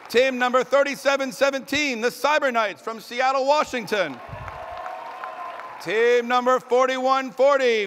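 loud, high arena announcer calling out each team. Acted. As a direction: boom every name across the arena like a hype announcer.

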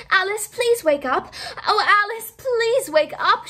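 high, loud, urgent plea; pleading cry. Acted. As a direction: beg her to wake, rising in panic. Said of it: "she heard herself cry".

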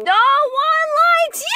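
high shouted taunt at a character. Real, not acted. Reacting to an anime, shouting at a villain. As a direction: yell it at the screen, high.

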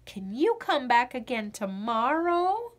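usual speaking voice

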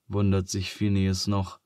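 plain reading voice

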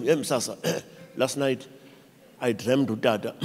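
low, hushed mock-solemn announcement; mock morning dream-teller. Someone waking reports last night's dream, mock-solemn and quiet. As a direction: clear the throat, then report it softly and low. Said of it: "wake up in the morning and say".

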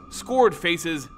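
ordinary delivery

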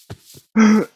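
sharp loud cough from a cold. Real, not acted. Streamer with a cold coughing into the mic. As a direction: cough hard, chest rattling. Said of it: "je suis un peu enrhumé".

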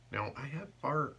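ordinary talk

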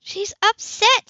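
high, slow protective voice; Sybil defending. A narrator's reading. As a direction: hold the line, slow and lifted.

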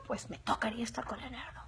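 cheeky whispered aside. Pretend. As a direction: whisper it like a guilty secret. Joking confession aside. (susurro pícaro en confidencia)

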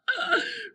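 high-pitched burst of laughter; whooping laugh. A narrator's reading. Boys pounding each other on the back, whooping laugh. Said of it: "Robert and Scooter whooped".

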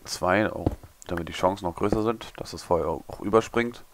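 normal talking voice

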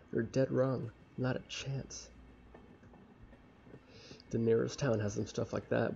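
ordinary talk, nothing marked

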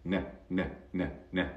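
short, soft repeated "N" sounds; isolated n sound. Shown as a demonstration. Teacher isolates the final consonant for learners, short repeated "N" sounds. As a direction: tap out "N" as separate nasal sounds. Not Pam.

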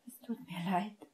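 soft unvoiced whisper; breathed whisper. A narrator's reading. Hermine whispers an apology to Severus, breathy. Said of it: "hauchte sie".

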